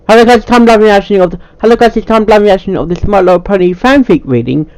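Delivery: cheery channel intro; upbeat intro voice. Acted. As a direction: sell the opening like a host. Intro take.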